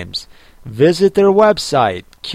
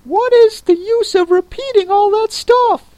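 very high creature voice cutting in; interrupting Mock Turtle; 4 dB louder than usual. A narrator's reading. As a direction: break in with a high, fussy turtle voice. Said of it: "the Mock Turtle interrupted".

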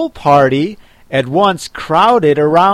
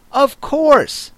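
high-pitched but grave assent; 12 semitones higher than usual. A narrator's reading. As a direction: agree with great gravity. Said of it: "the Dodo replied very gravely".

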